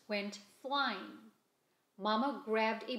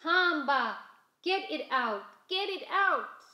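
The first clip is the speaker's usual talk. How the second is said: loud, higher yelling; panicked shouting; 6 semitones higher, 8 dB louder than usual. A narrator's reading. Taxi passengers yelling at the loose chicken, loud alarm. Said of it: "The passengers shouted".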